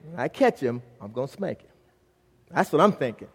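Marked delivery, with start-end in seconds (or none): 0.1-1.7 low, soft private vow; inner thought. Retold or imitated. Father searching church for his son, privately thinking. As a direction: let it sink low and quiet, as if to himself. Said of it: "The whole time I'm thinking".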